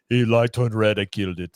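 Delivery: hammed-up Austrian action-hero accent; a lightly done Arnold Schwarzenegger voice; bad Arnold impression. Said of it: "a Bad Arnold impersonation".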